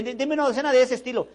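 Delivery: high, loud pushy customer asking for a dozen; eager customer. Retold or imitated. Customer at the shop, loudly asking for a dozen. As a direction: play the customer demanding stock.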